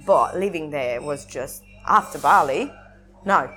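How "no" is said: ordinary delivery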